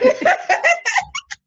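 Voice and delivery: loud high-pitched burst of laughter. Real, not acted. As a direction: shriek with laughter.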